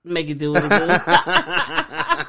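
put-on slow, drawn-out old uncle voice. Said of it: "You sounded like an unk over there".

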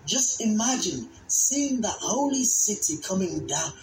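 ordinary delivery

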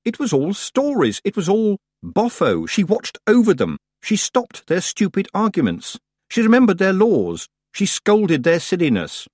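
high, urgent insistence; impassioned protest. A narrator's reading. Tiffany silently defends the dead witch, impassioned. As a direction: build a list of indignant denials. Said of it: "Tiffany wanted to yell".

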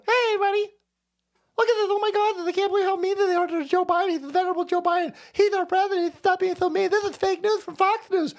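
very high-pitched, whiny mocking impression; Brian Stelter. Mocking a news pundit defending the president, squeaky and aggrieved. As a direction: do a squeaky, pleading caricature of a pundit. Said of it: "This is Brian Stelter".

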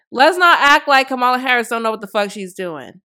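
normal talking voice

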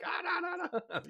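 mock yell. Car drivers yelling at them.

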